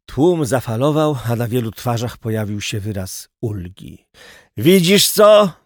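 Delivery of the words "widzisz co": impatient raised-voice question; loud, eager prodding. A narrator's reading. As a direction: call it out high and loud, pressing for an answer. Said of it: "dopytywał się niecierpliwie Szyper".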